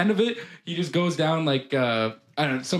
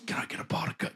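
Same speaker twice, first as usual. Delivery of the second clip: whispered request; 10 dB quieter than usual. Retold or imitated. Sleepy worker asking for coffee.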